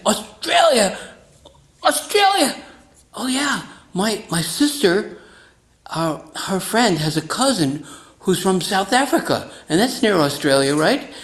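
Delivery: high, loud, hammed-up astonishment; mock amazement. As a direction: play it like he's thrilled by the word Australia. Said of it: "He said".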